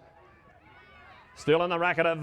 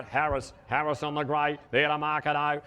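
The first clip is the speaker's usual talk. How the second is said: ordinary talk, nothing marked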